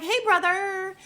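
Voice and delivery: loud, very high mock-excited hello; gushing greeting. Woman overgreeting Peter as family, mock gushing shout. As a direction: shoot the pitch way up, overfriendly.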